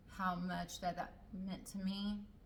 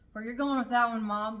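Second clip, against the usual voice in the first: exasperated retort to mom; 4 semitones higher, 13 dB louder than usual. Retold or imitated. Addressing mom sarcastically.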